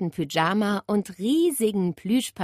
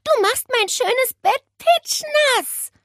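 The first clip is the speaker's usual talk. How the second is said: high, loud, indignant wail; slow, exasperated cry at a wet bird; scolding; 5 dB louder, slower than usual. A narrator's reading. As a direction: complain crossly, stretching the words. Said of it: "schimpfte Nele".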